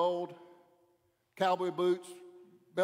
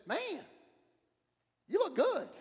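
much higher, drawn-out "Man, you look good"; mock impressed friend; 13 semitones higher, 4 dB louder, slower than usual. A friend compliments the referee, high mock gushing. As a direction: gush like a buddy admiring you.

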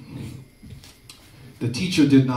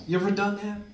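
ordinary talk, nothing marked

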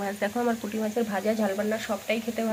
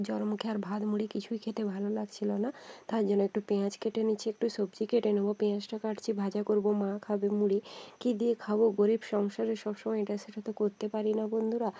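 usual speaking voice

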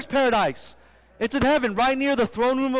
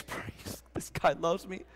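hushed, breathy-free whisper of joy; whispered delight; 6 semitones lower, 11 dB quieter, slower than usual. Pretend. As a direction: whisper it like a secret thrill. Wife's imagined thrilled reaction.

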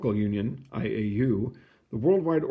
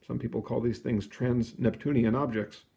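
ordinary delivery

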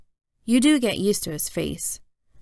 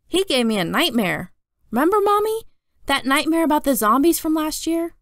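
lightly voiced young boy, a bit higher; little brother Jamie; 8 semitones higher, 3 dB louder than usual. Little brother tells Mom about his nightmare, light child voice. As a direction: play the kid brother eager to be backed up.

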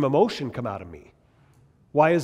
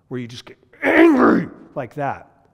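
mock angry flare; 9 dB louder than usual.

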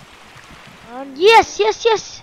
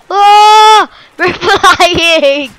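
excited shout; 3 semitones higher, 7 dB louder, much slower than usual. Real, not acted. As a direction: cry out in delight. Thrilled shout in game.

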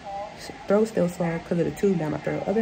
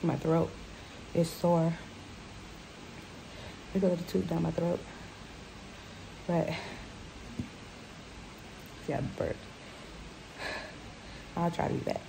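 weak and hurting; 9 dB quieter than usual. Real, not acted. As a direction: speak quietly in short bursts with long pauses. In pain after surgery, sore throat.

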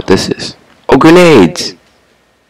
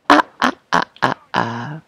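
panicked high ahs; 3 semitones higher than usual. Real, not acted. Panicked cries at enemy.

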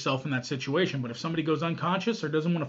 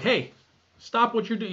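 loud, higher warning shout; firm shouted order; stern command; 7 semitones higher, 4 dB louder than usual. Retold or imitated. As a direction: call out to stop the attacker. Ordering an attacker to stop.

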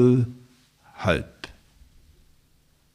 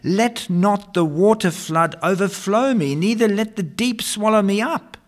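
building scripture reading; 5 semitones higher than usual. Acted. Preacher reading Psalm sixty-nine aloud, building to a plea. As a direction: climb from measured reading to a pleading peak.

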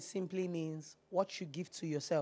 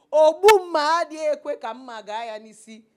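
loud proverb; 6 semitones higher, 13 dB louder than usual. Real, not acted. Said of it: "Let me speak it in Igbo".

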